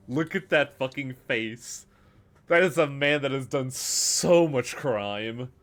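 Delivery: loud, delighted slow exclamation; excited outburst. Real, not acted. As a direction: gleefully call out the villain's face. Player reacts to character portrait.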